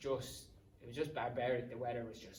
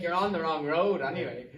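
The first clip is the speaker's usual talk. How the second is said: traveller telling him off; 5 semitones higher, 11 dB louder than usual. Retold or imitated. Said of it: "and he's like".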